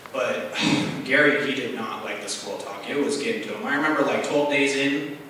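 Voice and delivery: usual speaking voice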